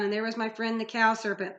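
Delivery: usual speaking voice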